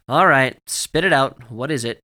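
loud, punchy demand, easing off at the end; demanding dad. A narrator's reading. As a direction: press a silly kid to confess, starting loud. Dad pressing giggling daughter at bedtime.

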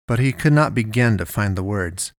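plain reading voice

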